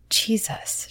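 soft unvoiced whisper; whispered thought. A narrator's reading. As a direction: breathe it under your breath. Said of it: "she thought".

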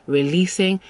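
ordinary delivery

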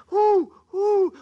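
high hooting owl who. Acted. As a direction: hoot it like a wise old owl. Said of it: "the wise owl".

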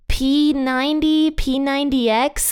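puzzled, high, drawn-out mishearing; confused listener voice. Retold or imitated. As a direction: play someone who mishears the name. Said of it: "they're just like".

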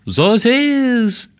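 high, drawn-out mock French; a long, high French-sounding word. Shown as a demonstration. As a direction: stretch it out in high-pitched French. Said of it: "do a French accent in a movie".

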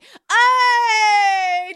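high, loud party holler; mock party shout. Partygoers yelling at the roommate, loud high mock holler. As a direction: yell it across the room, hammed-up. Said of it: "party goers are like".

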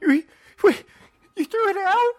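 high, wailing mock sob; hammed-up wail of dismay; mock crying boy. Boy wails to mom about the lost Tupperware, high mock sob.

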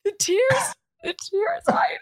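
hammed-up crying over the tears; mock sobbing. Fake crying over memo.

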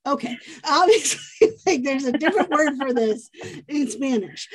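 laughing realization. Retold or imitated. A friend laughs at herself over the English word she can't find. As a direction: laugh it off as it dawns on her.

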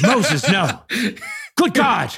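high, startled gasp of a woman jolted awake; sit bolt upright in scandalized delight; a hammed-up old woman shocked by gossip; shocked grandmother. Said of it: "she was like".

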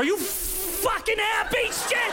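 high, slow sarcastic shout; bitter yelled question. Acted. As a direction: spit it at him. Mom yelling at son.

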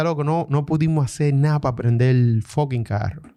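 usual speaking voice

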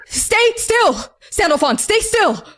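high, strained command; pleading order from a dad; urgent father. Acted. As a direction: urge your child to hold still, pitch jumping up. Father commands child mid-ritual.

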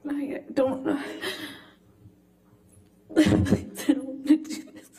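real sobbing, broken and high, words choked out; barely getting words out through tears; crying. Real, not acted. Breaking down, apologizing, can't continue.

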